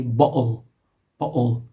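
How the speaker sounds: "bottle" Cockney style, the t dropped for a glottal stop. Shown as a demonstration. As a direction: say "bottle" as Cockney bo'le, with a catch for the t. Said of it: "in a Cockney English accent".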